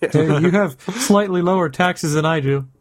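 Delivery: mock aggro Canadian. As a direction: play at being an outraged Canadian, drawing it out. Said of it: "That's how aggro Canadians get".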